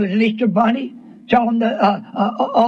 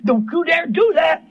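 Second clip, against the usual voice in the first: stern, higher-pitched reprimand; retold stern scolding; 6 semitones higher than usual. Preacher showing how he warned a tearful girl, stern. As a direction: warn a child off, retelling it firmly. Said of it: "how stern it was to me like that".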